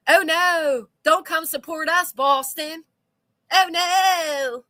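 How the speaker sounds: mocking high oh no; sarcastic. Real, not acted. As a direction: taunt them sarcastically. Sarcastic taunting.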